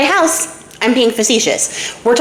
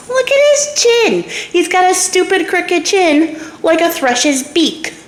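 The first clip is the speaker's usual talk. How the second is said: high-pitched, drawn-out ridicule; mocking princess; 8 semitones higher, slower than usual. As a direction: jeer in a high, slow singsong of scorn. Princess mocks a suitor's chin.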